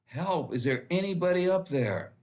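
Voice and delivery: high, loud cry upward; yelling for help. Retold or imitated. Man dangling from a branch, yelling up for help. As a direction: call up the cliff in alarm. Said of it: "And he yells up".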